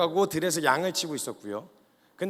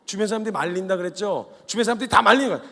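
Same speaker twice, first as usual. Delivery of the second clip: ordinary talk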